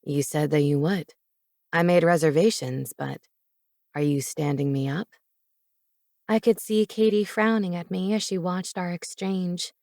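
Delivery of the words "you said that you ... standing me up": low, mock-hurt man; bewildered male voice. A narrator's reading. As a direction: play him baffled and low. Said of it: "He put on a bewildered expression".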